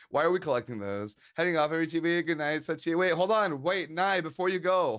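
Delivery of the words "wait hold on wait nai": normal talking voice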